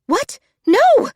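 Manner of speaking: high, loud frantic refusal; panicked shriek. Acted. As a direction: squeal no in terror of the spider. Rosie refuses to stand still.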